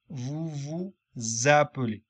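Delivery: ordinary delivery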